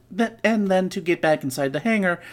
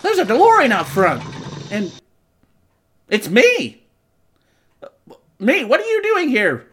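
excited surprise; 8 semitones higher, 5 dB louder than usual. Pretend. Speaker meets his future self.

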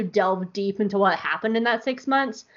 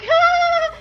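much higher, louder yelp; mock shriek; 8 dB louder than usual. Governor Price waves off civilian deaths, mock shriek. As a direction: fake a flustered shriek. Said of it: "Governor Price is like".

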